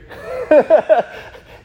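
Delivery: a loud high laugh; bright laugh, then settle. Real, not acted. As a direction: burst out laughing. Laughing at the guys' okay.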